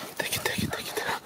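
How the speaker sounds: voiceless whisper, watching closely. Real, not acted. Hunter showing the dogs working to the viewer, whispered. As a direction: breathe it without voice.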